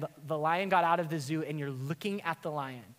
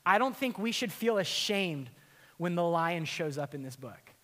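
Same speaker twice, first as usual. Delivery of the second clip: ordinary talk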